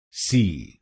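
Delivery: last syllable सी, isolated; syllable demo. Shown as a demonstration. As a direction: give सी as a clean final syllable. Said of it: "सीवाई को बोलिए सी".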